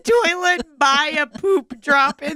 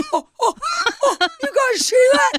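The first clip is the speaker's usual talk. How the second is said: very high, fast, laughing shout; excited high shouting; 11 semitones higher, faster than usual. Retold or imitated. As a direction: point it out in excitement. Excited pointing at owl.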